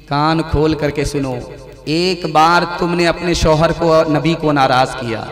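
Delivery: ordinary talk, nothing marked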